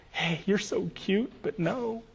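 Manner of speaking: soft, slow, sugary no; sweet refusal. Shown as a demonstration. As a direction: let them down kindly but firmly. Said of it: "as nice and as polite about it".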